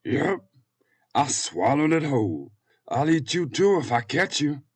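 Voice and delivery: high, loud menacing fat man; boastful fat man. Acted. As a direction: threaten like a hungry fat man.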